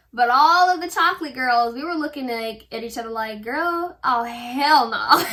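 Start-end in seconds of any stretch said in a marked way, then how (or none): 3.4-5.2 drawn-out, emphatic mock refusal; mock disbelief. Chocolate girls eye each other over lipstick, mock disbelief. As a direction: retell it slow and loud, appalled.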